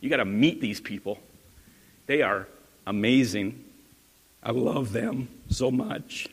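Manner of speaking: lightly voiced Paul, hushed and fond, slowing at the end; gushing Paul. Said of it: "That'd be Paul. He'd be like".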